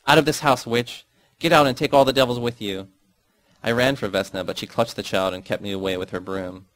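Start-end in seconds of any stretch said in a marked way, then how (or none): 0.1-2.9 loud, high furious scream; mother's scream. Acted. Mother screaming at her daughter to get out, loud and furious. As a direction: drive the witch out of the house. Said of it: "and screamed".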